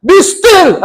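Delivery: loud, high rebuke of the storm; commanding shout. A narrator's reading. Jesus rebuking the storm, loud commanding shout. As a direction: silence the storm with one order. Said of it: "sabi niya sa bagyo".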